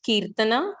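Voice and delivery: normal talking voice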